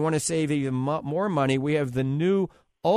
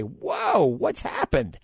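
mock amazement; 10 semitones higher, much slower than usual. Imagined amazed reaction.

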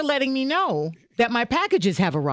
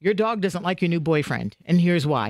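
much lower, gossipy dog translator; low dog interpreter voice; 9 semitones lower than usual. Pretend. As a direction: drop low and dish it like a pet psychic. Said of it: "what's my dog trying to tell me".

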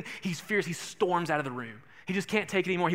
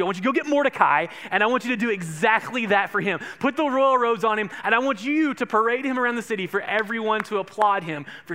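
loud royal command; 5 semitones higher, 5 dB louder than usual. Acted. King commanding Haman.

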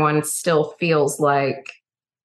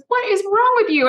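Dolly's question; 14 semitones higher than usual. Retold or imitated. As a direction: ask with shocked care. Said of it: "She goes".

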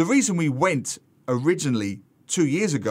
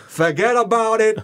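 loud, high New York catchphrase; hammed-up Italian-American New Yorker; New York accent; 7 semitones higher, 5 dB louder than usual.

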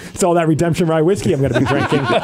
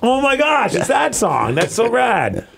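excited; 9 semitones higher, slower than usual. Retold or imitated. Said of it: "you're like".

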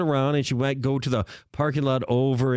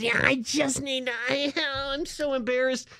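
flustered stranger losing her words; frantic embarrassed stranger; 8 semitones higher than usual. Retold or imitated. Imitating the woman at the pump, much higher and pleading. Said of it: "Literally, that's how she was talking".

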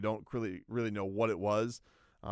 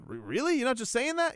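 surprised disbelief; 12 semitones higher, 3 dB louder than usual. Retold or imitated. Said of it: "He goes".

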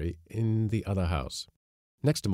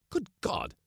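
cried out; 11 semitones higher, 3 dB quieter than usual. A narrator's reading. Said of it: "I cried out".

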